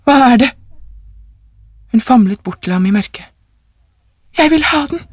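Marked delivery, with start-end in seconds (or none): 0.0-0.5 startled higher-pitched question; jolted awake, alarmed. A narrator's reading. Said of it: "Han for forskrekket opp". 4.4-5.0 high, agitated demand; urgent wife. A narrator's reading.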